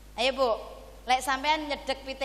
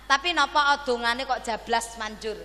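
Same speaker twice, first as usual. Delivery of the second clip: normal talking voice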